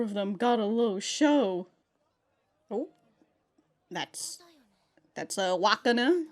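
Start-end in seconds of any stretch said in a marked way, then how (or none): none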